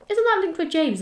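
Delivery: high, sudden surprise; a question that dawns on her; surprised realization. Retold or imitated. A podcaster recalls realizing the link, surprised.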